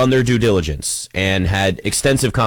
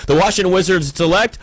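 announcer voice; 7 semitones higher than usual. Pretend. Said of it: "the commissioner will sneeze when he's announcing".

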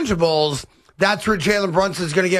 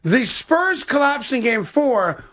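slow, higher-pitched demonstration sentence; deliberate test sentence; 5 semitones higher, slower than usual. Shown as a demonstration. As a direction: draw out the sentence to test how "historical" sounds in it. Trying word in a sentence.

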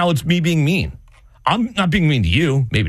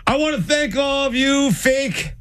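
mock speech; 8 semitones higher, slower than usual. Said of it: "should I write Nina's speech".

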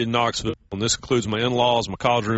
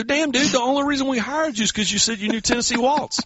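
much higher, disbelieving outburst; exasperated disbelief; 13 semitones higher than usual. Retold or imitated. Groom exasperated at bandleader, high disbelieving outburst. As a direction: cry out in exasperated disbelief at the band. Retold complaint to the band leader.

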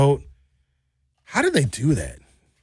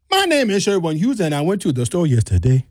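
Nigerian accent starting high, then dropping. Shown as a demonstration. Showing friend how Nigerians start high, Nigerian accent. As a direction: open high and sing-song in a Nigerian lilt. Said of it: "A Nigerian will start very, very high".

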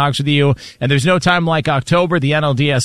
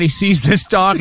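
ordinary talk, nothing marked

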